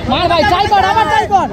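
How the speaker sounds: high, strained fan yell; yelling encouragement. Real, not acted. Spectator urging a shot.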